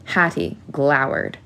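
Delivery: plain reading voice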